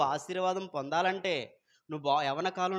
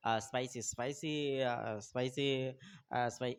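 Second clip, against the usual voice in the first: imitating a confused customer; 4 semitones lower, 6 dB quieter than usual. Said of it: "ఇంక ఇలాగే అంటున్నాడు".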